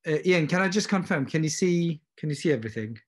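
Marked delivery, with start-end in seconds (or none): none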